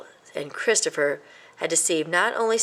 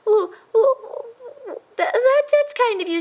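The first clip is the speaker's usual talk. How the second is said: high squeaky oohs of delight; gushing mouse; 5 dB louder than usual. A narrator's reading. A little mouse gushes thanks, high and squeaky. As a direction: coo with overdone gratitude.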